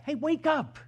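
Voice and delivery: high, loud demand; bold complaint to God. Retold or imitated. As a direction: shake God awake, indignant. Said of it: "they basically say to God".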